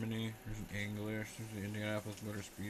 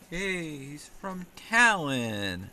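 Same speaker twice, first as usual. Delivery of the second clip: excited; 5 semitones higher, 9 dB louder, much slower than usual. Real, not acted. Excited find of Estonian card.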